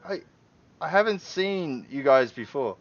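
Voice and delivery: can't believe what he's hearing; incredulous homeowner. Acted. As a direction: homeowner doubting the uniformed strangers.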